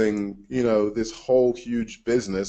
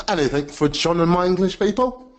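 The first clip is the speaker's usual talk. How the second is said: put-on playful English accent, pitched higher; 6 semitones higher than usual. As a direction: answer in a mock English accent. Answers host in put-on English accent.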